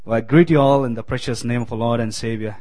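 normal talking voice

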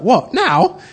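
startled, rising to a high squeak on now; surprised. Retold or imitated. Dave startled at being prayed for right now, surprised high and loud.

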